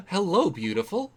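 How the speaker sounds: high, loud gasp then swooning hello; smitten gasp. Real, not acted. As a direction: gasp at a pretty maid, then greet her. Player smitten by English maid.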